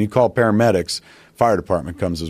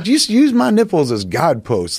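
flustered instruction; 9 semitones higher than usual. Retold or imitated. Embarrassed patient instructs the medic.

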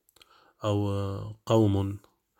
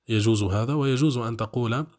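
ordinary delivery

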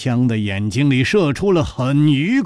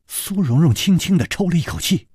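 ordinary delivery

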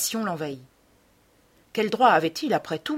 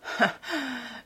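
soft, higher derisive laugh, falling away; bitter mocking laugh; 5 semitones higher, 6 dB quieter than usual. A narrator's reading. Dorian scoffing at the painter, bitter mocking laughter. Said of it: "Un rire d'amère raillerie".

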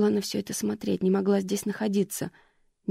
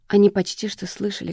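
ordinary delivery, nothing marked

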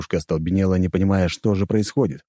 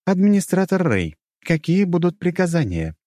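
high-pitched system assistant; high, official computer voice; 10 semitones higher than usual. A narrator's reading. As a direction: ask formally as an artificial intelligence. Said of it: "раздался голос ИИ".

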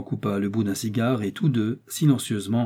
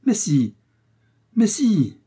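high, rising insistence, higher on the repeat; insistent contradiction; 9 semitones higher, 4 dB louder, slower than usual. Acted. Father-in-law dismissing worries about the creditor, insistently. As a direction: overrule the objection emphatically.

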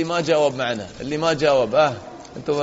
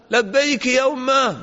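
higher, slow answering call; loud answering call; 8 semitones higher, slower than usual. Retold or imitated. Awn answering his mother's distant call, higher and slow. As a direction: call back across the distance, drawn out. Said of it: "بصوت عالي".

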